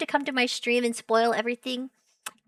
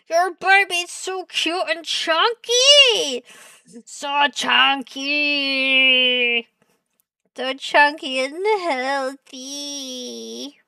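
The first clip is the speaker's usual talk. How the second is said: put-on gushing baby talk; 3 semitones higher, 5 dB louder, slower than usual. Shown as a demonstration. Streamer voicing a text to family, gushing slowly. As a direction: gush with every word stretched out. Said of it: "This is how I respond to messages".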